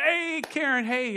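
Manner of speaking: a pastor breaking in on a runaway speaker; calling out to interrupt. Retold or imitated. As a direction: call her name sharply to stop the rant. Calling out to stop her.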